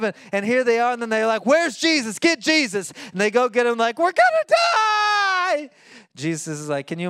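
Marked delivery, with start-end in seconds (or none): 1.5-2.9 panicked disciples. Acted. Panicked disciples call for Jesus. 4.0-5.7 slow, high, drawn-out panicked shout; terrified shout. Acted. Disciples wake Jesus in storm.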